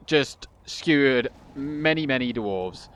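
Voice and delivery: ordinary delivery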